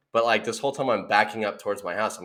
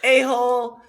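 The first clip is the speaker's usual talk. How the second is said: high, loud exaggerated "A-hole"; mock insult; 6 dB louder than usual. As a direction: ham up the cuss word. Replaying the cuss moment.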